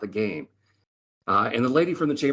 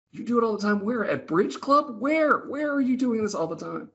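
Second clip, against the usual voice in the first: high, rising incredulous questioning; squeaky mock interrogation of the old lady; 13 semitones higher than usual. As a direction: demand to know where she learned it. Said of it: "speaker presses her in disbelief".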